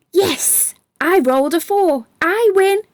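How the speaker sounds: high, bright cheer of winning; excited shout. Acted. Ryan cheering at Sam after winning the game, excited shout. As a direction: celebrate the roll out loud. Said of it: "shouted Ryan".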